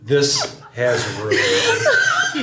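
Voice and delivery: very slow, drawn out through helpless laughter; collapsing into laughter; laughing harder. Real, not acted. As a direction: dissolve into it. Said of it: "my face hurts from laughing".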